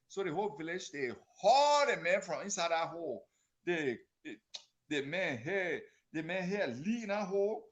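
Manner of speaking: usual speaking voice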